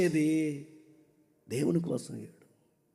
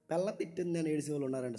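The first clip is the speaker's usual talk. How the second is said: normal talking voice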